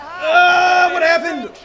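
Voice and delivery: high pained shout of dismay. Real, not acted. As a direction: cry out as the goal goes in. Goal conceded, streamer shouts.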